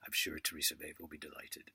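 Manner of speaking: whispered sarcastic aside; a hushed, mocking whisper of the title. Real, not acted. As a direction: breathe it like a secret title. Repeats title line in whisper.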